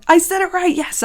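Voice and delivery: high, loud burst of triumph; thrilled. Real, not acted. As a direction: exclaim it, delighted to have got it right. Delighted she pronounced the name right.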